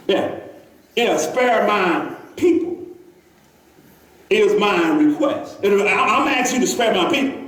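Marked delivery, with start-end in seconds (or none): 1.2-5.6 slow solemn request. Acted. Queen's request read slowly.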